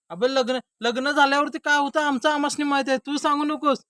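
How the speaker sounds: high, loud objection; heated retort. Acted. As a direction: cut the friend down at full voice. Married man retorts to bachelor. (चढ्या आवाजात प्रत्युत्तर)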